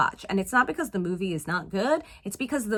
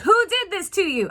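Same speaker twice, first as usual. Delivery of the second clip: protective mother; 9 semitones higher, 5 dB louder than usual. Retold or imitated. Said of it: "protective lioness mode".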